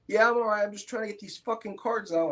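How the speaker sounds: impatient brush-off. Retold or imitated. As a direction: wave off the question while distracted. Brushing off repeated questions.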